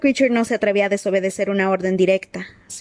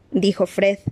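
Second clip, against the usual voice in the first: ordinary delivery, nothing marked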